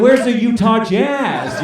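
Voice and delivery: drawn out like a lost fan asking; puzzled orphans. Retold or imitated. Orphans asking where the Utah Jazz are, slow and puzzled. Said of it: "They're like".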